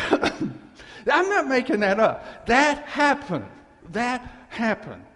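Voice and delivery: real laughing emphasis; laughing insistence. Real, not acted. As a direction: laugh while swearing it's true. Insisting true story through laughter.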